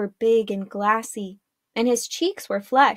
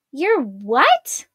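louder, higher shocked question; startled and higher-pitched; gasped disbelief; 4 semitones higher, 5 dB louder than usual. A narrator's reading. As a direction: gasp it out, stunned. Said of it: "Arella gasped".

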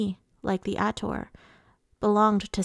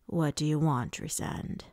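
low, restrained threat; hint at violence, dropping away at the end; understated, lower-pitched menace; quiet menace; 5 semitones lower, 4 dB quieter than usual. A narrator's reading. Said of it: "laced with the promise of violence".

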